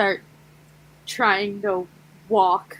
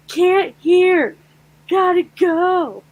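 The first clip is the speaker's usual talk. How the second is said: exaggerated mouthing; 8 semitones higher, 6 dB louder, slower than usual. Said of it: "stereotypical sign language signs".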